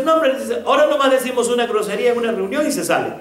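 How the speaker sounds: high, loud, fast exclaiming; retold exclamation. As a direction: rattle it off like an amazed brother.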